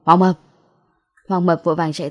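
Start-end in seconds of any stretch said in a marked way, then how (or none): 0.0-0.4 calls out a name, louder; calling out. A narrator's reading. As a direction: shout his friend over. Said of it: "Lâm Hiểu Phong hô".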